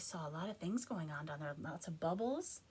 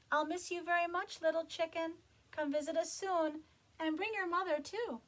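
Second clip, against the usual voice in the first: put-on high, louder girl voice; Jessica's voice; 10 semitones higher, 6 dB louder than usual. Jessica saying goodbye to the chicken. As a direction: speak it as young Jessica. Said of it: "said Jessica".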